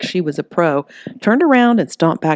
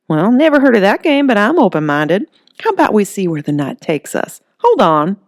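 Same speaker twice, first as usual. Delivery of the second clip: a lightly voiced flirty woman, higher; flirty Holly; 5 semitones higher, 5 dB louder than usual. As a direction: tease him, playful and coy. Holly answers Earl.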